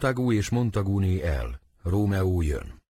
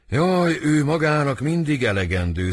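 higher-pitched theatrical lament; acted lament; 5 semitones higher than usual. Lovesick Romeo to his cousin about love, lamenting. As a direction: cry out woefully, as in a stage play.

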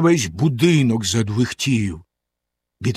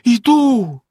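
despairing; 10 semitones higher, 5 dB louder than usual. Acted. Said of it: "розпачливо сказала".

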